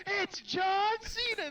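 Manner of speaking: loud, high, stretched-out yell; announcer-style meme shout. Acted. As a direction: holler it suddenly, dragging out the name. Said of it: "all I heard is".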